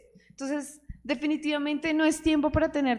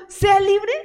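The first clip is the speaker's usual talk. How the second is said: loud, higher proclamation; a loud, higher-pitched preacher's proclamation; proclaiming freedom; 8 semitones higher, 9 dB louder than usual. Real, not acted. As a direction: ring out "Sea libre" like a declaration. Proclaiming God's word.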